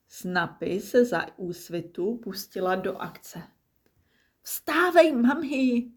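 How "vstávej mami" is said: very high, bright wake-up call. Acted. Little croc calling mum awake at sunrise. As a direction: sing out to wake mum at dawn.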